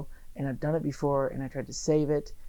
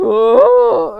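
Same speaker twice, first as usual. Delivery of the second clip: loud drawn-out oh; 6 semitones higher, 13 dB louder than usual. Retold or imitated. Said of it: "he just went".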